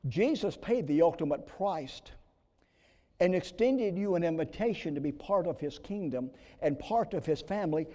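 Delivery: ordinary talk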